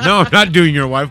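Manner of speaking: loud shocked denial; shouting. Real, not acted. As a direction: yell it out in disbelief.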